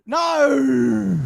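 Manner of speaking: loud, high cry of real dismay. Real, not acted. A fan just drops his catch, and he yells in dismay. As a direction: let out a gutted shout.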